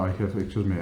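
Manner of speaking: ordinary talk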